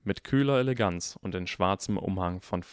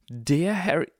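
higher, emphatic astonishment; gasped disbelief; 7 semitones higher, 3 dB louder, slower than usual. A narrator's reading. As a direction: gasp it, stressing "Der". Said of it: "keuchte Draco".

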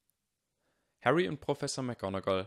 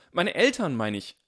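loud and higher, hastily fixing the slip; flustered correction; 7 semitones higher, 9 dB louder than usual. A narrator's reading. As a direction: correct yourself too loudly, caught out. Harry correcting his outburst.

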